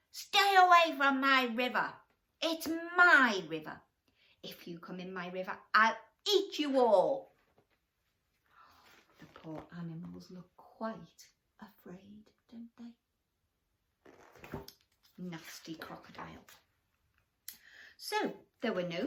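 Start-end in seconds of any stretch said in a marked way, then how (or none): 0.1-3.8 loud, high crocodile roar; shouting. A narrator's reading. Selfish crocodile shouts at the forest creatures. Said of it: "he shouted to the creatures". 4.4-7.4 threatening crocodile. A narrator's reading. Crocodile warns the animals away, menacing. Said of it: "he shouted to the creatures". 9.2-16.5 soft, breathy whisper to the little ones; hushed aside. Acted. Storyteller confides to the children, whispering.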